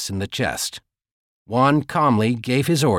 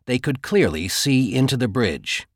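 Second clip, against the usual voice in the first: usual speaking voice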